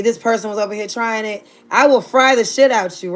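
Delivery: ordinary talk, nothing marked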